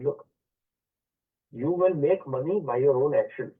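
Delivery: normal talking voice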